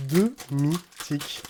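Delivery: drawn-out, syllable by syllable; slow stunned repeat. Real, not acted. Repeating the rare card pull to himself, slow and stunned. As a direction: let each syllable of "deux mythiques" land slowly.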